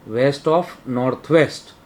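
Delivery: each word drawn out, rising at the end; very slow dictation. Shown as a demonstration. Teacher slowly naming the zone for the class, drawn out. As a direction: spell the direction out slowly for students. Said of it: "teacher spells out direction name".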